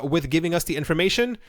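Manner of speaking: ordinary talk, nothing marked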